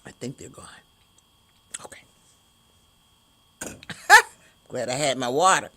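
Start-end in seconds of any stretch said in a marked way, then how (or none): 0.0-2.0 whispered aside; whispering. Real, not acted. Checking if the hiccups are gone. 3.6-4.3 loud high laugh. Real, not acted. Relief after the hiccups.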